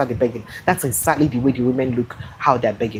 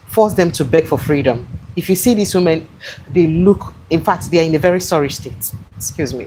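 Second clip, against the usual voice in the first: ordinary talk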